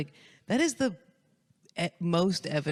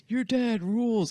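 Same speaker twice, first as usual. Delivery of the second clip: partygoers cheering; 6 semitones higher, slower than usual. Retold or imitated. Partygoers quoted.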